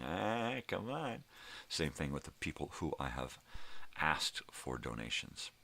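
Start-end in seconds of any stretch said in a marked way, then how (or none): 0.0-1.2 high, whiny mock plea; teasing high-pitched coaxing; mock whine. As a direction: whine it like a disappointed pal.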